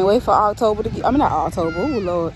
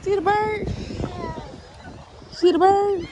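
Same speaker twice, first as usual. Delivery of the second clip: higher, sing-song coaxing to a little one; 7 semitones higher, 5 dB quieter than usual. Real, not acted. Mother urging her baby toward daddy, lilting baby talk.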